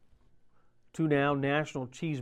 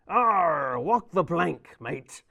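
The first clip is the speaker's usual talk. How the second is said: gruff pirate growl, louder; 3 semitones higher, 6 dB louder, much slower than usual. Acted. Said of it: "National Talk Like a Pirate Day".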